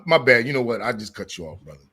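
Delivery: normal talking voice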